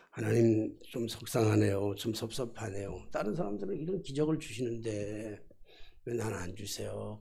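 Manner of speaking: low, subdued grumble; grumbling. Retold or imitated. Pastor quietly complaining to God about others' miracles, grumbling. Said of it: "그리고 이제 투덜대면서".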